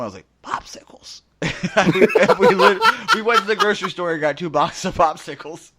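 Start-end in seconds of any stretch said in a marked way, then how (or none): 0.4-1.2 voiceless croak. Retold or imitated. Strep throat, no voice. 1.4-5.7 high and loud through real laughter; laughing. Real, not acted. Finishing the popsicle story to his co-host, laughing high and loud.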